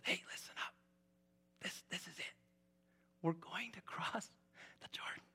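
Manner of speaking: hushed secret whisper to the crowd; whispered announcement. Acted. Joshua gathering the people, whispered.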